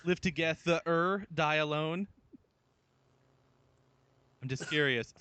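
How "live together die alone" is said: "together" slowly split as to-ge-THE-er; slow "together", with "the" sounded as its own word; THE inside together. Shown as a demonstration. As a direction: pull "together" apart so "the" stands out. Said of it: "if the isn't another word".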